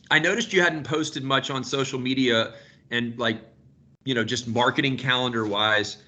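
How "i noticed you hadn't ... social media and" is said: ordinary talk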